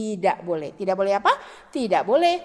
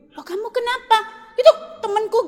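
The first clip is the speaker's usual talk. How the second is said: mock childish protest; 7 semitones higher, 5 dB louder than usual. Child acting out friend quarrel.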